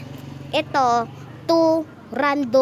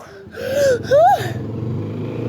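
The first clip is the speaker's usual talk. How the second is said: gasping laugh; 12 semitones higher than usual. Real, not acted. Laughing at his own fun.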